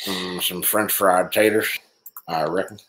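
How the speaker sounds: low, slow hillbilly drawl; put-on hillbilly accent. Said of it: "you came in with your accent".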